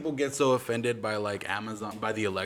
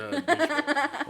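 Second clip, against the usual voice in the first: laughing; 6 dB louder, slower than usual. Real, not acted. Laughing insult at himself.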